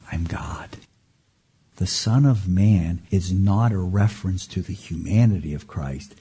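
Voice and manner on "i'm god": hushed whisper. Acted. Revealing Jesus' claim, hushed.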